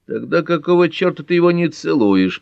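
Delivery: jealous grumble from the dark; grumbling man. A narrator's reading. Carter from the dark at Pat, jealous grumble. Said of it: "проворчал из темноты мужской голос".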